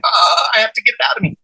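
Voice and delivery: high, hurried retold outburst; groan, then blurt it out, mock-desperate; desperate urgency. Man desperate to tell his witnesses the download, acted urgency.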